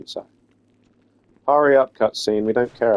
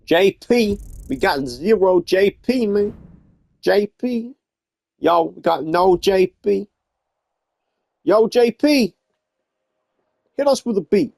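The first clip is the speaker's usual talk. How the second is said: rapper hype call; 9 semitones higher, slower than usual. Acted.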